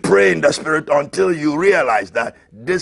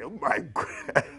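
ordinary talk

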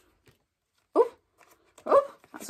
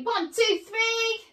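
rousing count; 10 semitones higher, slower than usual. Pretend. Waking them up with a count.

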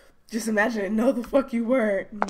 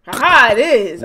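excited; 3 semitones higher, 13 dB louder than usual. Real, not acted. Finds the solution, gleeful.

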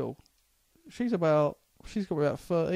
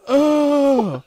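loud, high, jeering shout; mock crowd yelling; 10 semitones higher, 9 dB louder, slower than usual. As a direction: rile up the whole queue with a loud, high jeer. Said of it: "everyone starts going".

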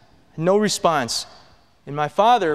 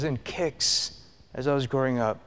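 usual speaking voice

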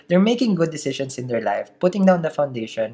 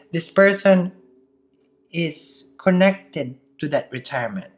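ordinary talk, nothing marked

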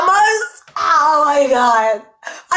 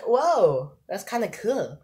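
muted past reaction; 5 semitones lower, 7 dB quieter, much slower than usual. Retold or imitated. Recalling the paranormal pack reaction, subdued. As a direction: imitate an underwhelmed, soft "whoa". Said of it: "if you guys saw my paranormal pack reaction".

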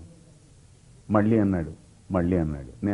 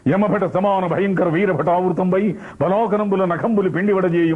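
long mocking laugh, then high declamation; S V Ranga Rao; 4 semitones higher than usual. Shown as a demonstration. Actor as Chanakya scorning Chandragupta, grand derisive declamation. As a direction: scoff theatrically and roll out the ornate verse. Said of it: "Rangarao గారు చాణక్యుడు వేస్తే ఎలా ఉంటుందని".

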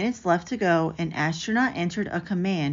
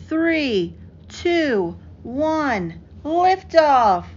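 rising higher and louder to a cheering liftoff; excited countdown; 7 semitones higher, 4 dB louder, much slower than usual. A narrator's reading. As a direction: count down like mission control, burst out on liftoff. Countdown to Apollo launch.

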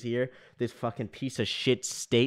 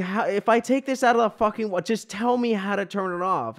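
high, raised-voice retold plea; exasperated demand; 8 semitones higher, 3 dB louder than usual. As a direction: cut yourself off and demand an answer in frustration. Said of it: "getting angrier and angrier".

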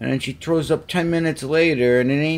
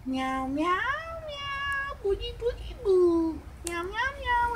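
squeaky high kitten meows, softer; 7 dB quieter than usual. Pretend. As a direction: meow way up like a kitten. Meowing at the cat.

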